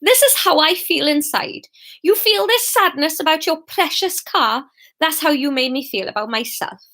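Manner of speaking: put-on Welsh-accented, cutting contempt; furious Fiona. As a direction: threaten the ex with rising spite. Fiona rages while scratching the car.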